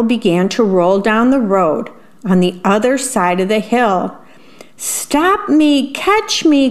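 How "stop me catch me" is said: higher, alarmed little worm; panicked cry. A narrator's reading. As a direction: cry for help as you roll away. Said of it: "cried the worm".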